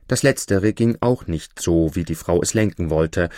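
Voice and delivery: ordinary delivery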